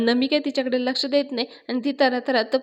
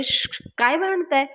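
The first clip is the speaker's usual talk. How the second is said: sharp shushes, then higher-pitched question; hushing reproach; 5 semitones higher than usual. Acted. Avani comes out to the noisy quarrel, hushing them. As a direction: shush two people who are squabbling.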